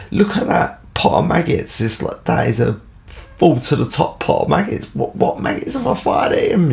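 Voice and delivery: rising to a high squeak of disbelief; incredulous protest. Retold or imitated. Boy shows the policeman the full maggot pot, incredulous. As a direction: protest innocence, voice cracking high. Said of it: "I said".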